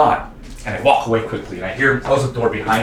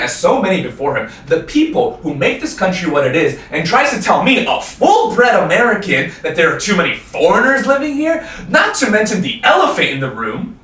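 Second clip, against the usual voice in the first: indignant rant, climbing higher and louder; building indignation; 6 semitones higher, 4 dB louder than usual. Acted. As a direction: work yourself up to a high-pitched accusation. Indignant rant building to outburst at father.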